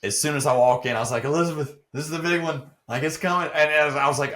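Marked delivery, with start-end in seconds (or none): 1.2-3.5 higher, louder mock heart-attack routine; Fred Sanford. Man walking into the gym jokes about dreading it, hammed-up alarm. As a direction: play a hammed-up sitcom panic. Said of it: "do you remember Fred Sanford".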